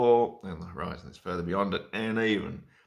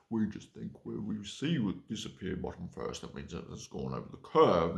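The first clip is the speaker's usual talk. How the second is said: loud, lecturing ancient philosopher; pompous Aristotle; 6 dB louder than usual. Retold or imitated. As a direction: boom it out like a smug philosopher. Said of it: "That's the sort of shit they probably thought".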